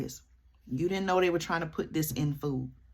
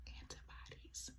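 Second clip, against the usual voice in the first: whispered; 29 dB quieter than usual. Real, not acted. Quoting the bill, dropping voice.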